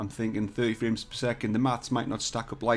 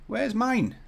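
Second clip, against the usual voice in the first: much higher plaintive question; high-pitched complaint; 11 semitones higher than usual. Pretend. Streamer hunting for his own model, high plaintive voice. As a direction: wail it lightly, pitched right up. Searching in vain, asks again.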